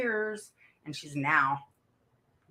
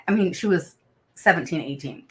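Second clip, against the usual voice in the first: ordinary talk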